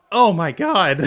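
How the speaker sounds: loud, high shocked oh my god; shocked outburst. Real, not acted. Player stunned by the dice outcome yells in shock. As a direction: yell it in sheer disbelief. Surprise win in dice game.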